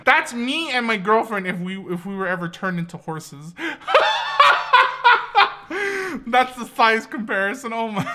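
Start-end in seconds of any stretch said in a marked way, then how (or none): 3.6-6.2 high, loud sustained laugh; laughing hard. Real, not acted. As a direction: crack up helplessly. Laughs at horse joke.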